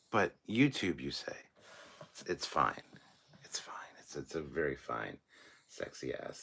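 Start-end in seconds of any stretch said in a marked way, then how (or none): 4.0-6.4 whispered, lower, teasing; whispered tease about his car; sultry whisper. Pretend. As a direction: hush it like a secret you're savoring.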